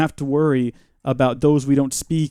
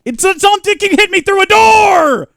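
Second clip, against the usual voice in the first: high frightened shout; panicked shout; 8 dB louder than usual. Real, not acted. Gamer yelling as monster hits through door, panicked. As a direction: cry out in alarm.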